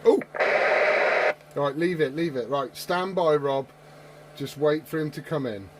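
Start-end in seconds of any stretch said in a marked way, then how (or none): none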